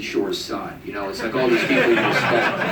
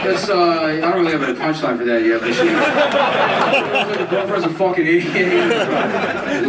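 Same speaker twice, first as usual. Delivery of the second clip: high, slow, smug comic voice; lazily riff like a hack comic; mocking comic; 6 semitones higher, 3 dB louder, slower than usual. Continues open-mic comic impression.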